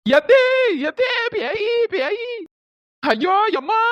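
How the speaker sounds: high frightened yells of ghost; daughter-in-law screaming ghost as she runs in. Acted. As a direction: shriek for your mother-in-law in terror. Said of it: "கத்திட்டே வருது பாரு".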